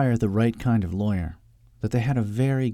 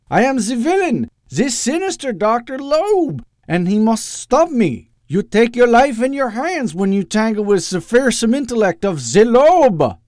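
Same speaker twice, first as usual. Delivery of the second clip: theatrical supervillain; 13 semitones higher, 8 dB louder than usual. Acted. Eustace as Doctor Loeb challenges the hero, grandiose villain voice. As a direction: declaim it like a ranting cartoon villain.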